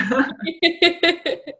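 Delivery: bright high trailing laugh; real laughter after the quip. Real, not acted. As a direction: laugh it off freely.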